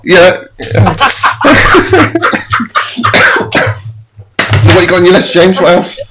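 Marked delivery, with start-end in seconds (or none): 1.0-3.9 unvoiced, high wheezing laugh; real helpless laugh, no voice; co-host laughs after the shop story, high and breathless. Real, not acted. Both crack up laughing.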